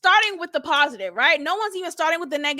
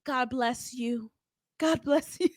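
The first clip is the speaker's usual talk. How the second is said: softer, slow, prim imitation; a hint of a pastor's blessing; mock prim blessing; 4 semitones lower, 7 dB quieter than usual. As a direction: say it like a pious churchgoer. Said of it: "She said".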